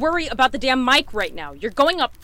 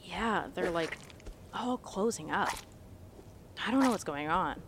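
hushed; 3 semitones lower, 11 dB quieter than usual. Acted. Hushed walking past plants.

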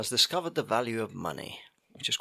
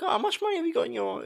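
mock sweet dad. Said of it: "And I was just like".

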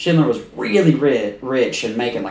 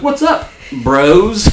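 mock bro voice; 8 semitones higher, 5 dB louder, much slower than usual. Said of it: "she's like".

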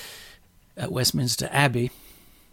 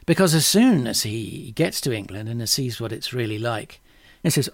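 ordinary talk, nothing marked

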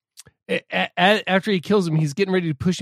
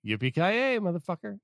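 higher, soft and offhand; casual throwaway; 5 semitones higher, 7 dB quieter than usual. Shown as a demonstration. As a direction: toss it away like an afterthought. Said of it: "You're like".

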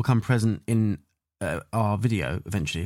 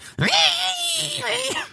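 falsetto squeal with a laugh; high squealing imitation. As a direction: squeal the tune up top. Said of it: "it just goes".

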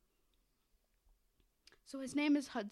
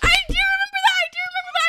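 very high, loud excited squeal; excited shouting; 12 dB louder, much faster than usual. Real, not acted. Telling co-host the voice is remembered, excited high shouting. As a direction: shout it in sudden recognition.

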